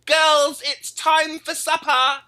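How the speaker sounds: put-on high, loud, slow English boy calling; small boy in English accent calling the girls to supper; little English boy accent. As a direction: announce supper like a proud tiny English boy. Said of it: "little English boy accent".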